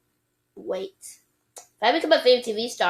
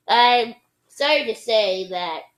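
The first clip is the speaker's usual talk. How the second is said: ordinary delivery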